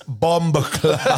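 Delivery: BOMBA CLOT drawn out slowly, Jamaican-style; "bomba clot" stretched out with open Jamaican vowels; proper pronunciation demo. Shown as a demonstration. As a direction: teach "bomba clot" slowly, through laughter. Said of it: "teach you how to say it properly".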